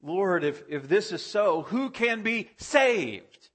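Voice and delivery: high, slow, dismayed plea; disciples' astonished question. Retold or imitated. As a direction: stretch the question out in disbelief. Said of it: "They say".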